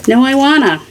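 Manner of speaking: ordinary talk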